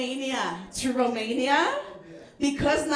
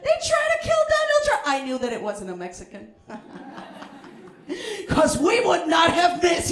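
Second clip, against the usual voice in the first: ordinary talk, nothing marked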